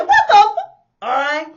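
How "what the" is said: much higher, louder indignant yell; outraged shout. Retold or imitated. Friend yelling at the man who grabbed her. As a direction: cut off the curse mid-yell. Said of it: "she said".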